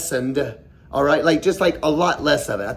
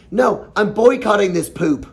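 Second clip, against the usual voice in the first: ordinary talk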